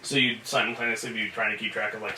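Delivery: normal talking voice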